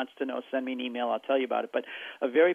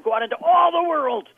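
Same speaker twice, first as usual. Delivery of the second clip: high, loud, ringing call to the world; proclaiming command; 13 semitones higher, 8 dB louder than usual. Retold or imitated. Jesus sending disciples out, loud and high. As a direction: proclaim it like a commission. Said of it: "waving his arm".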